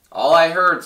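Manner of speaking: loud, sharp retort; snapped. Acted. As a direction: bite the words off at him. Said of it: "snapped the big kangaroo".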